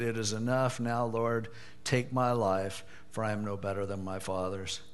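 a defeated man asking to die; despairing prayer. Retold or imitated. Preacher reading Elijah's prayer for death, soft and weary. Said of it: "he prayed that he might die".

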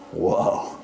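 normal talking voice

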